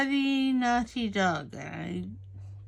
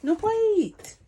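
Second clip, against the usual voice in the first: much higher, louder "No bite" to the dog; high loud command to the dog; firm scolding; 12 semitones higher, 6 dB louder than usual. Real, not acted. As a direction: make the dog let go now.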